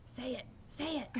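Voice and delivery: high-pitched, insistent prodding; mock-excited urging; eager egging on. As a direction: imitate friends begging for a party trick. Retold friends egging them on.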